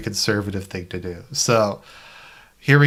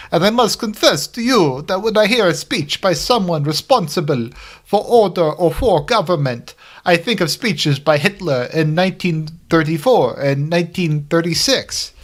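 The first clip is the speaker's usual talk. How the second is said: quoting the Pope; 6 semitones higher than usual. Retold or imitated. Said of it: "And he said".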